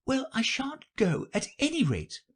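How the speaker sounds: put-on very high girlish refusal; high, unforced girl voice; defiant Alice. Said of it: "said Alice".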